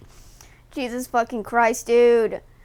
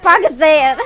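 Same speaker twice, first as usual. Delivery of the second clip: mock battle cry; 3 semitones higher, 8 dB louder than usual. Quoting meme as she throws dirt.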